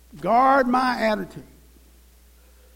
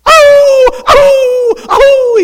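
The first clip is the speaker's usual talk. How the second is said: high, loud yelping dog; mock dog squall, high-pitched; 11 dB louder, much slower than usual. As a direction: yelp like the dog the rock hit. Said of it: "he said".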